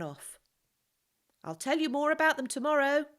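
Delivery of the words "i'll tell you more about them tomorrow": higher and louder, calling after someone; shouting after the fleeing boy. A narrator's reading. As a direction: call out to a boy running off. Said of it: "Joseph called".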